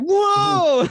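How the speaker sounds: high, loud startled whoa; surprised shout. Retold or imitated. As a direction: jump back with a yelp. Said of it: "we were just like".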